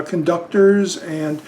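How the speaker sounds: normal talking voice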